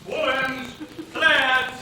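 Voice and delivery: high, loud hawker's call; street vendor's cry. Acted. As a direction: call out your wares to the market. Market seller calls out wares.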